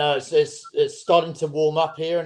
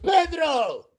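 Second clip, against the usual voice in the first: calling out; 12 semitones higher than usual. Pretend. Mock calling to a player.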